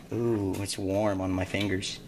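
usual speaking voice

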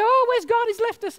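high, mock-whining complaint; whiny doubter. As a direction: whine like a lost believer. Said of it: "and we go".

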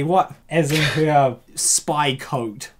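normal talking voice

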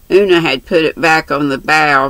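ordinary delivery

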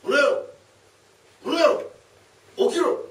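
high, loud shout to wake a sleeper. Acted. A thief shouting loudly at the sleeping man to wake him. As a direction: bellow him awake.

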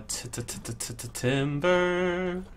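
soft repeated t's sliding into a drawn-out word; playful stutter. Pretend. Streamer placing a timber wolf, playful stuttered lead-up. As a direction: stutter the t, then stretch "timber".

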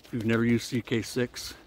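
ordinary talk

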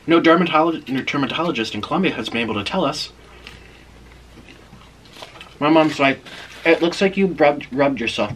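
chewing while talking; slightly muffled chewed words; mouth full of pizza. Real, not acted. Seen 2: Bites pizza at -1.0, then lip and cheek movement while talking.

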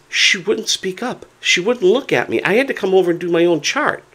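high-pitched retold exasperation; aggravated complaint. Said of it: "I'm aggravated".

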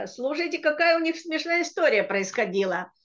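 ordinary delivery

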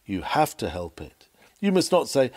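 ordinary delivery

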